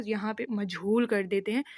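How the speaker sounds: usual speaking voice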